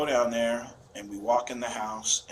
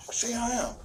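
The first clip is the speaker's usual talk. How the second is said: imitated angry son; 12 semitones higher than usual. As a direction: mimic the son daring him, pitched way up. Father voices son's knife threat.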